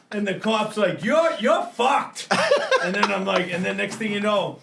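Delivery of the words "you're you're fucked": higher, slow ominous warning; cop warning. Retold or imitated. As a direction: tell him he's done for.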